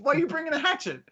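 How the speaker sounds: high squeaky disbelief; a high, baffled question; incredulous squeak. Retold or imitated.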